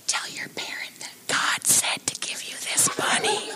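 hushed, secretive whisper; conspiratorial whisper. Pretend. Pretending to hand a kid the bunny, secretive whisper. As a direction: whisper it like a mysterious stranger with a secret. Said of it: "and we say".

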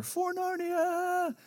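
put-on high, slow, far-off kid's battle cry; child's battle cry. Daughters playing Narnia in the woods, distant battle cry. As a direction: call it out like a little knight in the woods. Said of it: "I'll hear".

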